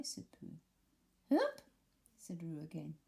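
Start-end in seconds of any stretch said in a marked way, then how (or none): 1.3-1.6 high little hiccup; squeaky hiccup. A narrator's reading. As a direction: hiccup out a tiny hup. Roo hiccups again.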